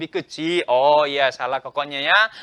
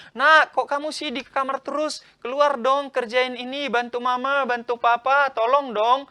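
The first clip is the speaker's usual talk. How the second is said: parent calling; 7 semitones higher, 4 dB louder than usual. Retold or imitated. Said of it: "papa atau mama ngomong".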